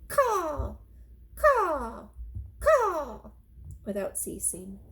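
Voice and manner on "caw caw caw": high, harsh cawing crow. Acted. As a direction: scream like a crow. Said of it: "screamed a dark message".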